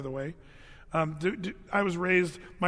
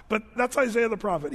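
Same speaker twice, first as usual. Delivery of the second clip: usual speaking voice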